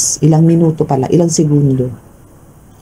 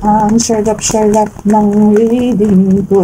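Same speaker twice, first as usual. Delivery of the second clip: delighted; 6 semitones higher, slower than usual. Real, not acted. Delighted by own reading.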